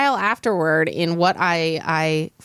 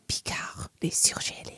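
whispering; 12 dB quieter than usual. Acted. Sexy whispered French phrase.

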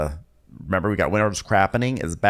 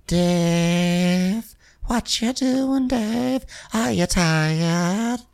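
higher, slow teasing call; teasing sing-song; 6 semitones higher, much slower than usual. Retold or imitated. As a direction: draw it out, teasing him. Voicing Natasha teasing Dave.

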